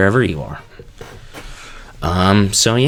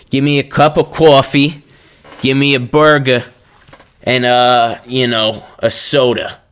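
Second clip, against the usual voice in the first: put-on loud, higher-pitched New York cabbie accent; 5 semitones higher, 6 dB louder than usual. Cab driver sending Jimmy for food, brash New York accent. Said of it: "that's my New Yorker accent".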